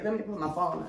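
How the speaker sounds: ordinary delivery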